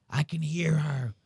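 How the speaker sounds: slowed, dazed mock of a man losing it; a slow, spooked imitation of the homeowner; mimicking the frantic guy. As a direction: echo him like he's hearing voices. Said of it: "He's, like, losing his mind".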